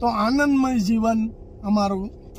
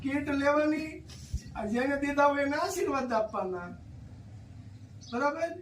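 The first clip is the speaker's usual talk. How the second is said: gentle consoling; 4 semitones higher, 7 dB quieter, faster than usual. Real, not acted. Visitor comforts a crying elderly woman, softly coaxing. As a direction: soothe her like calming a grandmother.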